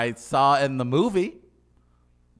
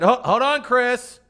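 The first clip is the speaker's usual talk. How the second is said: mock executive; 10 semitones higher, 6 dB louder than usual. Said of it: "Someone said".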